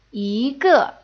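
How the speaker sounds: "yi" rising, "ge" falling sharply; second tone yi. Shown as a demonstration. Teacher shows the changed tone, "yi" rising. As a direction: lift "yi", then drop "ge". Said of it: "will change into the second tone".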